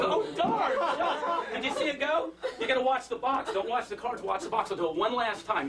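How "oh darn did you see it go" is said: high-pitched mock dismay; imitating audience member. Woman in audience reacting to the trick, mock dismay. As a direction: imitate her in a much higher voice. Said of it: "She goes".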